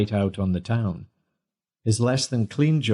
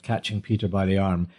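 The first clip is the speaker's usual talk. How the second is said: ordinary delivery, nothing marked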